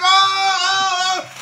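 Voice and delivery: high, loud, stunned "oh"s; stunned delight at being recognized; amazed. Retold or imitated.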